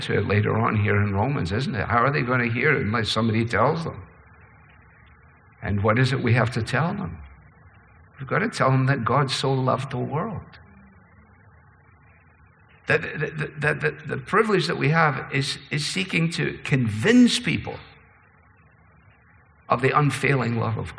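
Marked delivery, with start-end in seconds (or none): none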